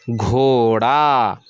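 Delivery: each sound drawn out, घोड़ा as ghoo-raa; teacher blending the spelled letters into घोड़ा, slowly; slow blended reading. Shown as a demonstration. Teacher to Urdu pupils, drawing out the word slowly. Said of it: "आड़े अलिफ ज़बर रा".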